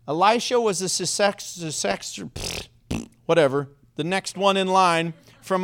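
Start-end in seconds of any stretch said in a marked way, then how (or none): 2.2-3.1 two sputtering puffs after a tongue-tangle; exasperated little "pfft, pfft"; blown raspberry. Real, not acted. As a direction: give up on the word with a lip-buzz. Trips over the word successor.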